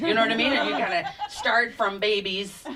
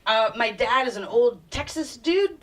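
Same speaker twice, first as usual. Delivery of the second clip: ordinary delivery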